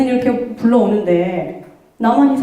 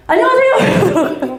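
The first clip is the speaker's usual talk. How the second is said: put-on kid's eager hello; 7 semitones higher, 3 dB louder, slower than usual. Kid running up greeting.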